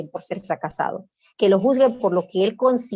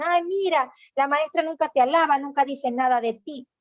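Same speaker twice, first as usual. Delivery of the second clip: a lightly voiced mother, higher and nagging; reproachful mother; 5 semitones higher than usual. Mother complaining the teacher never praises her child, higher nagging voice. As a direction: mimic a disappointed mother sighing at her child.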